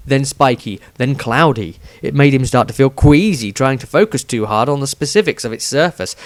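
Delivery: plain reading voice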